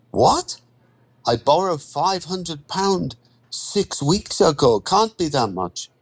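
put-on high-pitched, incredulous foreign-accented voice; shocked protest. Borrower hearing how much he owes, shocked. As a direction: leap up in pitch on the first word and stay high in disbelief.

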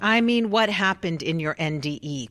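loud, forceful question; insistent demand. Acted. Interviewer forcing Pamela to answer question eleven, loud and insistent. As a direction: push her to finally answer.

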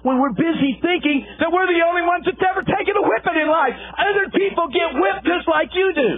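high, ringing preacher's cry to the congregation; rising shouted sermon voice; preaching at full force. Acted. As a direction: hammer the point home from the pulpit.

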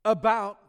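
"About" said high and loud; stress on About. Shown as a demonstration. Preacher flagging an emphatic word to the congregation, loud. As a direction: punch "About". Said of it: "look at the emphatic word".

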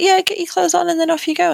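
breezy, dismissive send-off; brisk nurse. Retold or imitated. Nurse repeating the order to leave, breezy and offhand.

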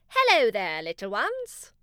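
loud, sing-song hello from a friendly unicorn; melodious unicorn voice. Acted. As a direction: greet the little bunnies musically. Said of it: "said Clarence in a melodious voice".

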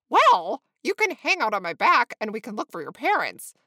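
loud, keen offer; emphatic and helpful young bird; eager flamingo. A narrator's reading. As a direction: pipe up brightly with a plan.

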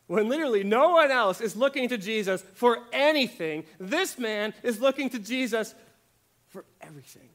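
high, loud, fervent proclamation; impassioned preaching. Acted. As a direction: throw it out with full conviction. Preacher peaks.

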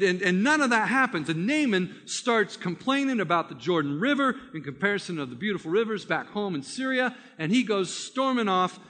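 ordinary talk, nothing marked

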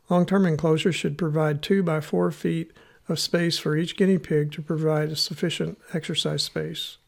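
ordinary talk

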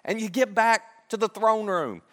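high-pitched, insistent pleading; earnest plea. Acted. Joseph asks the cupbearer to remember him, pleading. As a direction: beg for a favor, pitch lifted. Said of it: "Joseph makes a plea".

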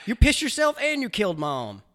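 high, loud cartoon father; mock dad voice. The father scolds his son, high cartoon voice.

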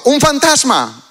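high, drawn-out cry of alarm; frightened cry. Acted. Disciples on the boat spot someone walking on the sea, frightened cry. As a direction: cry it out as if seeing a ghost. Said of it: "dieron voces de miedo".

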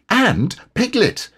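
excited, very high and drawn out; delighted discovery, voice soaring; Christopher Robin. A narrator's reading. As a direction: exclaim with childlike excitement. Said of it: "said Christopher Robin excitedly".

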